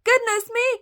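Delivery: high, loud giantess in a fluster; alarmed cry. A narrator's reading. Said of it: "cried the giantess".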